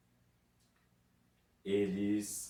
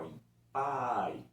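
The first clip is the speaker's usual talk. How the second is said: "pai" slow with the a stressed; slow, strong a; 2 semitones higher, 3 dB louder than usual. Shown as a demonstration. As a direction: stretch the a in pai. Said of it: "E eu posso ter o contrário". ("pai" devagar, "a" forte)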